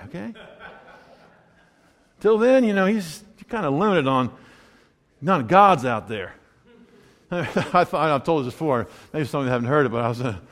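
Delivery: usual speaking voice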